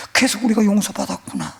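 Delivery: preaching. Acted. Preacher telling congregation about grace, fervent. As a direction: build to the sermon's climax.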